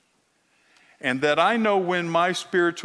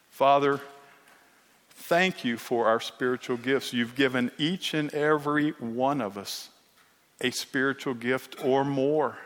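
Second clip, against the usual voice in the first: soft, steady prayer voice; closing prayer; 3 semitones lower, 3 dB quieter than usual. Acted. Pastor leading congregation in prayer, quiet and reverent. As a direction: pray quietly to the Father.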